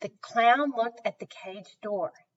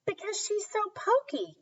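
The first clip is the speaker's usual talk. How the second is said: put-on child brother; 12 semitones higher, 4 dB quieter than usual. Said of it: "Jack said".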